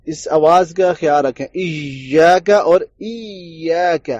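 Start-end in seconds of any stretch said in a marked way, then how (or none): 1.5-4.1 ایاک said twice slowly, the second with a pure ya; contrasting two pronunciations. Shown as a demonstration. Teacher contrasting the wrong and right ya, slow. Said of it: "دونوں میں فرق ہے".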